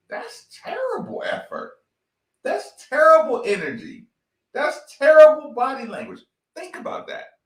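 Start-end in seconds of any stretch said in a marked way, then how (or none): 0.1-6.2 a hint of a disgusted TV commentator; mock the broadcaster's verdict, rising louder; quoting the commentator. Podcaster mocks the TV commentator's verdict, lightly voiced. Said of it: "heard the commentator say".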